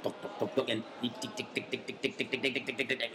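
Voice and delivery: soft clicking tok and tick; video game noises. Retold or imitated. Actor mimics simple game bleeps, soft ticking sounds. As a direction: tick away like a clock, quietly. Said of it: "I only know".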